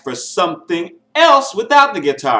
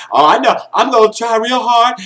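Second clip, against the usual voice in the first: higher, louder mock pleading; imitated begging contestant; 7 semitones higher, 5 dB louder than usual. Reviewer mimicking a contestant begging the judges, mock pleading. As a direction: lightly mimic an earnest, begging singer. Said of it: "she was begging and stuff".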